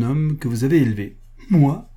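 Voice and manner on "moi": un « Moi ? » aigu et fort, incrédule; il n'en croit pas ses oreilles; shocked cry. A narrator's reading. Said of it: "s'écria l'archiduc". (l'archiduc s'écrie, stupéfait)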